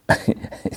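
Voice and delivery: low-volume mock chuckle; soft, voiceless put-on laugh; imitated laugh. Acting out the guy's laugh.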